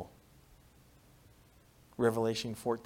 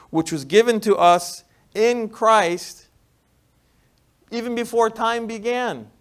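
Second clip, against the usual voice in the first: louder preached repeat; 5 semitones higher, 9 dB louder than usual. Shown as a demonstration. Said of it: "Let me repeat that".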